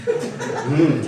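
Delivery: ordinary delivery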